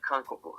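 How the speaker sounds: "Kankoku" as kan-ko-ku, Japanese style; Japanese reading. Shown as a demonstration. Teacher showing how Japanese read the characters, "Kankoku" as kan-ko-ku. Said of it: "read it a slightly different way".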